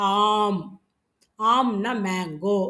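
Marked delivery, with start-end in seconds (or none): 0.1-0.6 ஆம் as one long syllable closing on m; ஆம் held long, no vowel after m; model pronunciation. Shown as a demonstration. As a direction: draw out ஆ and close the lips on ம். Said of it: "உச்சரிக்கும் பொழுது".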